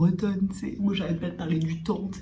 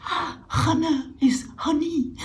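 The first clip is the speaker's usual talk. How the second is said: laughing; 11 semitones higher, 3 dB louder than usual. Retold or imitated. Mock realization, laughing.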